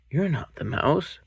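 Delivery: a cub questioning the owl; Gruffalo's child. Acted.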